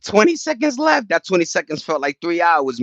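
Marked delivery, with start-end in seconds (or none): 0.0-1.1 much higher, bursting with excitement at the score; excited outburst. Retold or imitated. Watching the Knicks' final seconds, higher excited yelp. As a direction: yelp it out like the game's slipping away. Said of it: "I'm like".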